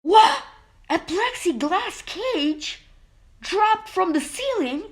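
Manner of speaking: surprised. Acted. Trapped in a sudden cage.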